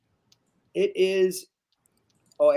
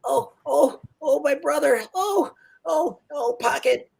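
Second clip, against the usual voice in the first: high, crying little gnome voice; high weepy gnome sobbing; tearful gnome; 11 semitones higher than usual. Acted. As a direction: choke up over his lost brother. Gnome grieving over brother.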